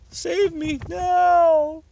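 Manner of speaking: high, slow, drawn-out wail; desperate plea. A narrator's reading. Captain pleading to headquarters, slow high wail. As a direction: stretch it out like a begging cry.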